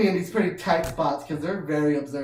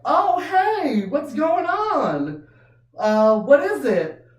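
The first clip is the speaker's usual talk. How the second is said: high mock-friendly greeting; 11 semitones higher, 6 dB louder, much slower than usual. Greeting the laughing kid.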